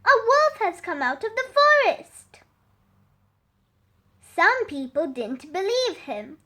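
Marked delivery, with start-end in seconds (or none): 0.0-2.4 high, fast alarmed call; shouting. Acted. Sam calling villagers, high fast shout. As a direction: rush the warning out. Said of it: "he shouted".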